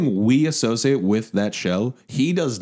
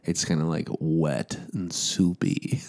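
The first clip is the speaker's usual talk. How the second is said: slow, soft whisper; 8 semitones lower, 6 dB quieter, slower than usual. Real, not acted. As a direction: whisper it slowly. Whispered description.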